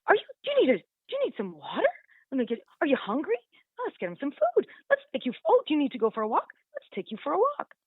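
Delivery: high, fussing coo with big swoops; doting owner baby talk. Retold or imitated. Owner fussing over the puppy, high cooing baby talk. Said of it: "see if they need".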